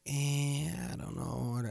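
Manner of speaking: soft, noncommittal shrug of a voice; hesitant people. Retold or imitated. Host voicing people unsure about retirement, softly hesitant. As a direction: imitate someone unsure, trailing off softly. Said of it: "and some people like".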